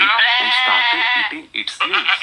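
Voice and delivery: high, slow and loud; excited telling. A narrator's reading. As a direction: make the goats' rush exciting. Goats rush at the tree. (ઊંચા, ઉત્સાહિત અવાજે)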